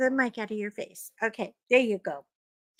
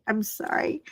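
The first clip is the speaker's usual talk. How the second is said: normal talking voice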